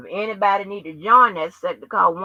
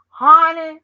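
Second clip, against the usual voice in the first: honey burst out much higher and louder; excited high honey; 10 semitones higher, 10 dB louder than usual. Real, not acted. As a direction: cry out honey in delight. Exclaims at the memory.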